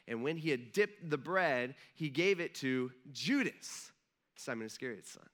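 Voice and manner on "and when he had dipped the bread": ordinary delivery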